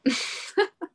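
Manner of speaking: quick high giggle; light laugh. Real, not acted. Guest laughs at her own question, giggling. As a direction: laugh it off at yourself.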